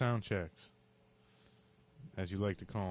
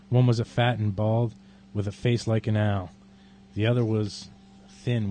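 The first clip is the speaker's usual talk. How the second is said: measured dramatic poem reading; poetry reading; 13 dB louder than usual. Acted. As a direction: read the poem deliberately and darkly. Reads Bukowski poem aloud.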